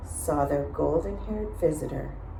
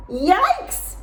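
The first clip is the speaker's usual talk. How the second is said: high, loud startled cry; a girl waking to three bears; Goldilocks gasping; 14 semitones higher, 5 dB louder than usual. A narrator's reading. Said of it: "she gasped".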